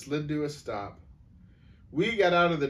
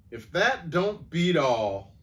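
slow, louder, exasperated; drawled exasperation; 5 semitones higher, 5 dB louder, slower than usual. A narrator's reading. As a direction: drag out the disbelief.

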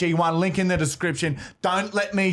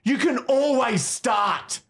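high, shouted tough-love motivation; drill-like pep talk; 5 semitones higher than usual. Acted. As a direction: holler them into action, stretching key words. Aggressive motivational ad pitch.

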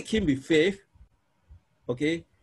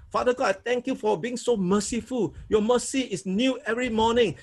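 higher, earnest thanks to the Father; thankful prayer; 4 semitones higher than usual. Shown as a demonstration. Teacher turning the word mercy into prayer, higher earnest thanks. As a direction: pray it back to God with gratitude. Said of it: "I can take this word".

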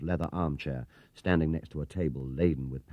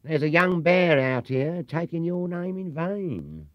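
higher and slow, calling out amused; mocking call; 10 semitones higher, 4 dB louder, slower than usual. Clerk telling the owner about the bear, mocking. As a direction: call it back through the door.

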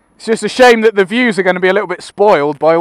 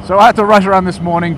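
usual speaking voice

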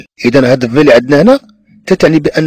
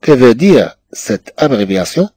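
slow dictation; much slower than usual. Shown as a demonstration. Teacher dictating French question.

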